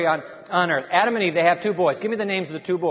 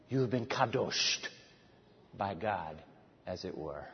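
fading hush; 11 semitones lower, 12 dB quieter than usual. Real, not acted. Preacher drops to a hush.

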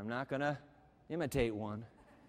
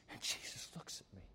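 breathless whisper, much lower and softer; hushed remorseful whisper; 10 semitones lower, 15 dB quieter, faster than usual. Acted. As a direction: breathe it out in awe. Peter remembering, whispered.

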